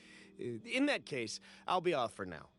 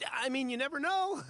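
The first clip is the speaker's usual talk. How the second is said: higher teasing line that breaks into a laugh; 8 semitones higher than usual. Acted. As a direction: toss off the jab, then laugh at it. Teasing accusation, then a laugh.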